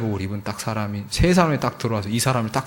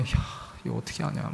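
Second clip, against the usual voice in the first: whispered worry; 12 dB quieter, faster than usual. Retold or imitated. Retold anxious inner thought.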